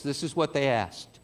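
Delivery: normal talking voice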